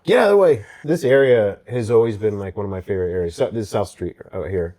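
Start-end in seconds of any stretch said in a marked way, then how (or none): 0.0-0.6 mock angry driver. Mimicking honking Philly drivers.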